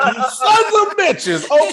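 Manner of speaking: hammed-up "you sons of bitches"; mock outrage. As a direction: drag it out in comic betrayal. Realizing internet raised money.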